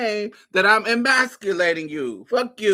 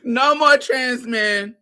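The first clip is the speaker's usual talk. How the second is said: laughing; 6 semitones higher, 3 dB louder, slower than usual. Real, not acted. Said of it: "laughing repeat".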